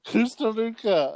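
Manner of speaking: high-pitched mock South African accent; silly high South African twist. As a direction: mimic the botched South African-sounding accent. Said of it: "must be from South Africa".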